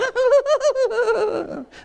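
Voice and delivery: high, rapid hyena cackle; loud, stuttering mocking cackle; hyena laughter. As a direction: imitate hyenas cackling at the cubs. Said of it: "the hyenas are laughing at them".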